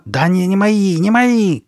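high, loud frantic denial; shouting. A narrator's reading. Carly Beth ignoring her friend, shouting. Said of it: "кричала Карли Бет".

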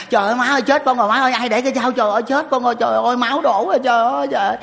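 high, loud fake cry of pain; mock yell. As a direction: wail like a kid faking a bad wound. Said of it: "con la ra". (giả la thất thanh, giọng cao và lớn)

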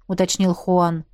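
ordinary delivery

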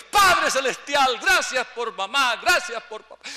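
slow, loud, high thanksgiving prayer; fervent prayer. Retold or imitated. Son praying over the gathered mothers, loud fervent prayer. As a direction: pray aloud before the whole family. Public prayer at family party.

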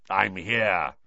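put-on rough, wheezy old voice; wheezy growl. A narrator's reading. Moody arriving to tell Dumbledore, wheezy growl. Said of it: "said a wheezy growl".

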